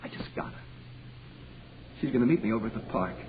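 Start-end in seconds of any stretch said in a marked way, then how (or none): 0.0-0.5 desperate breathy whisper. Acted. Skip begging Danny for help, in a whisper. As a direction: plead it under your breath. Pleading to see Ruth, whisper.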